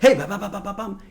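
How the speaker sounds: rapid-fire patter, loud and high at the start; fast-talking New Yorker. Acted. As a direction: burst in with quick, pushy babble. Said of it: "their tone was much slower, the pace was slower".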